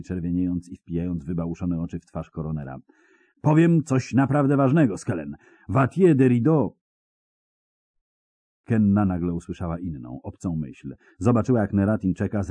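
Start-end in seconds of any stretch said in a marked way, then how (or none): 3.4-6.8 desperate blurting. Acted. Spy desperate to talk.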